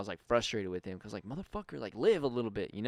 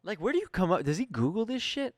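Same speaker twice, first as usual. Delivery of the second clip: incredulous; 11 semitones higher, 8 dB louder than usual. Real, not acted. Baffled at who wrote question.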